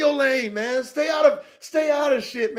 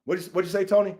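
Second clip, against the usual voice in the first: ordinary delivery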